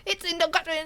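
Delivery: high mock English country accent. Retelling asking for directions in England, unintelligible English accent. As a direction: imitate a hard-to-follow English local, high-pitched. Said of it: "less intelligible".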